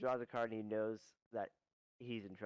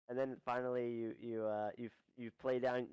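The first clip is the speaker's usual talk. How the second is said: ordinary talk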